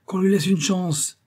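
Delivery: high, loud, slow crew shout; vociferating. A narrator's reading. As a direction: clamour slowly for a last chance. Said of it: "ils vociférèrent".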